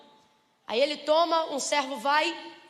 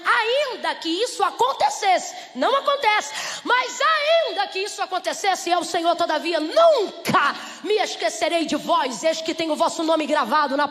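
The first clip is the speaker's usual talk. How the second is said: preaching with force; 2 semitones higher, 6 dB louder than usual. Acted. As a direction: proclaim it with conviction. Pregação enfática sobre Deus. (pregação enfática, lenta e alta)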